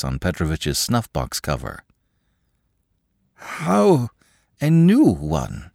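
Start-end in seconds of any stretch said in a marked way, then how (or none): 3.3-5.7 high, slow and dazed; as if still dreaming. Acted. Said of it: "as if still in a dream".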